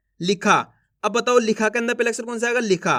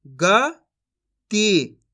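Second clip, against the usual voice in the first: गति very slowly split as "ग ... ती"; syllable by syllable; much slower than usual. Shown as a demonstration. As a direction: sound out गति with a long gap inside. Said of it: "एक अक्षर का उच्चारण करके". (गति को तोड़कर, धीरे-धीरे)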